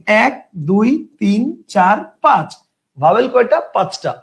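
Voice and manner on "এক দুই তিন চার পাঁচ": slow counting. Shown as a demonstration. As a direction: count এক, দুই, তিন, চার, পাঁচ very slowly. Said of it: "দেখেন". (ধীরে ধীরে গুনে দেখানো)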